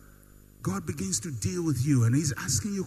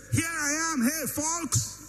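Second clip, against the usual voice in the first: high-pitched showy hailing of the crowd; mock self-announcement; 8 semitones higher than usual. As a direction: announce yourself to folks, hammed-up. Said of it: "show myself and say".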